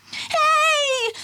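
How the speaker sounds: very high cry; a startled cry after him; called out. A narrator's reading. Warden cries out as the elephant runs off with the meter. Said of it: "called Brenda".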